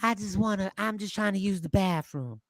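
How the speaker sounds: a touch of a pretend woman asking to use the bathroom; mock feminine voice. Host puts on voice when friend asks to hear it, lightly feminine. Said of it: "Let me hear you".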